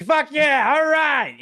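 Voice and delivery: over-the-top celebratory shout; hyped fan cheer. Retold or imitated. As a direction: yell it like a pumped-up fan. Meme voice cheering Italian starter.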